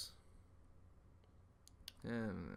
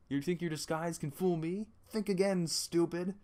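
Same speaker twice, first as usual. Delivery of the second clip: taunting; 6 semitones higher, 5 dB louder than usual. Acted. Guard taunting.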